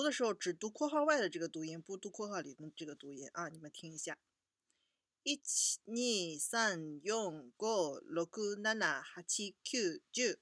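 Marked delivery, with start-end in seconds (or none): 5.2-10.4 ichi to juu read in one go; counting in Japanese. Shown as a demonstration. Teacher reading the numbers one to ten in Japanese. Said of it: "一到十我一口气给你念下来".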